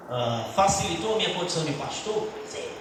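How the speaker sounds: ordinary talk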